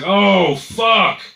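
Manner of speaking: high, loud, drawn-out mock anguish; distraught driver. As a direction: wail it over a ruined car. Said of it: "put his hands on his head".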